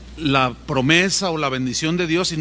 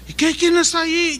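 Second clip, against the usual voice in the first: calling down; 10 semitones higher than usual. Retold or imitated. Passer-by calls down the cliff.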